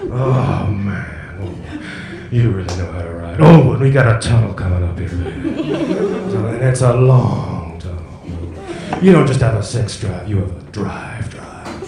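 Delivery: low, slow, seductive crooning GPS voice. Pretend. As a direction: purr it like a smooth soul singer.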